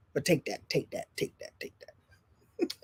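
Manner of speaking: fading from a soft tease into a whisper; teasing whisper. Real, not acted. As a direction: whisper it, dropping away each time. Teasing after controversial claim.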